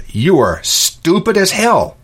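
drawn out, pitched high and scornful; exasperated insult. Retold or imitated. Shop owner venting about a customer, slow high scorn. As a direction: let the disbelief spill out slowly. Blurting out what he thinks.

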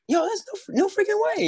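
high-pitched mock astonishment; excited disbelief. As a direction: squeal it like unbelievable luck. Retold reaction to meeting neighbor.

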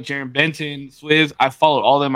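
ordinary talk, nothing marked

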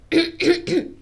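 dry cough; coughing fit. Real, not acted. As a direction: cough hard. Coughing, noted by S1.

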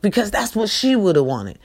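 ordinary delivery

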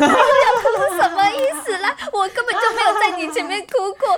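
mock outrage, laughing through it; laughing indignant retort. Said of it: "然后我就很愤怒".

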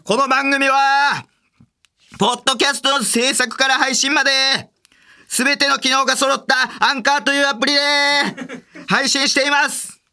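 声を張り上げた高い応援団口調; スポンサーに応援団長のように叫んでエールを送る; 応援団のエール. Acted. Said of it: "スポンサーさんへの応援団でした". (応援団風に全力で絶叫)

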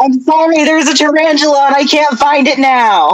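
high-pitched, flustered apology; flustered panic. Real, not acted. Panicking over a tarantula in game.

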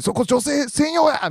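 high, slow mock shout; retold call-out. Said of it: "みたいなのめっちゃ言われて。".

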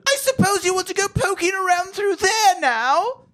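put-on very high, slow shrieking old woman; hammed-up falsetto old woman crying out; shrill old lady. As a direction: squawk it like a batty old lady. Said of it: "Missus Applegate cried".

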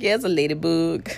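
understated, unimpressed reply; retold deadpan. Patient answering staff about her tattoo, retold deadpan. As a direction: answer the cooing with no excitement.

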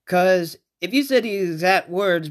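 ordinary talk

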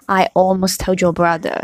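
ordinary delivery, nothing marked

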